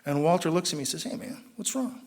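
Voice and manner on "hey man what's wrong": hushed, breathy question, raised pitch; soft concern. Retold or imitated. Old-timer leaning over to a shaking newcomer, hushed concern. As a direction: lean in and ask it gently.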